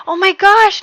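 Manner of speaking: high, loud burst of delight; a quick thrilled gasp; excited. Retold or imitated. Husband hearing the second pregnancy, excited. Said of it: "really excited for a second".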